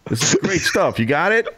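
fake TV crew cue. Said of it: "started talking into my sleeve".

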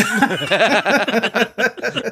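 bright high giggle; high laugh. Real, not acted. Laughing at own impression.

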